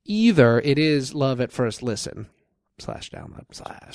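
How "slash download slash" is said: soft whispered throwaway; whispered aside. Real, not acted. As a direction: whisper it under your breath. Aside while listing ways to listen.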